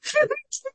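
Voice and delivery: a sudden high-pitched laugh. Acted. Rabbi acting out Rabbi Akiva's laugh at the ruins, high laugh.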